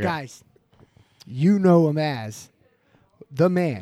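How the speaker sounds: low, soft, slow mellow intro. Acted. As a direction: lay it down low and smooth. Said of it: "do mine hella mellow".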